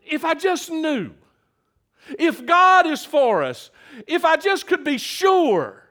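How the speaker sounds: high, emphatic longing to be sure; imagined doubter's earnest wish; wishing for certainty. Acted. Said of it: "Paul was saying".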